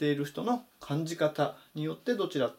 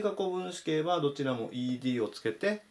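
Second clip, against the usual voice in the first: normal talking voice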